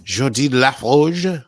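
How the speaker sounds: slow loud French "Geordi LaForge"; French accent. Acted. Mock French pronunciation.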